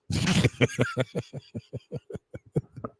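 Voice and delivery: long, soft run of chuckles; amused laugh. Real, not acted. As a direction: laugh quietly at your own joke. Laughing at own rat junior joke.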